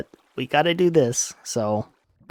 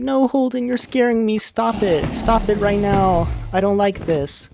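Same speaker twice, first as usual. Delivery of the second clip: high-pitched mock distressed girlfriend; scared protest; 7 semitones higher than usual. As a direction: protest in a raised, pleading voice. Sally begging Holden to stop.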